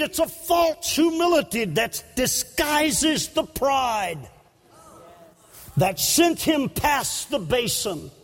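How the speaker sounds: usual speaking voice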